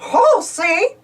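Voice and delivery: chuckling. A narrator's reading. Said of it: "Mickey laughs".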